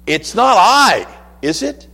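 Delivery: hope for a no, fall away on the tag; expecting a no. Shown as a demonstration. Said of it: "You hear the difference?".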